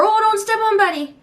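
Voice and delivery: put-on high, quick alarmed call to the toddler; edgy warning. Mom calling out to her toddler near a cabinet, high and edgy. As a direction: snap out a nervous warning.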